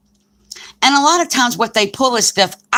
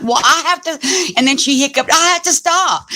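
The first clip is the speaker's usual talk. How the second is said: higher, slowed, breathless alarm between hiccups; panicky high voice; 9 semitones higher than usual. Retold or imitated. Woman fretting over her hiccups, higher and slowed. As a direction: play the scared hiccuping patient.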